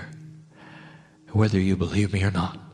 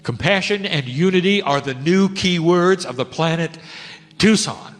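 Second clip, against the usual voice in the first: raised, ringing declaration; loud proclamation; 10 semitones higher, 6 dB louder than usual. Acted.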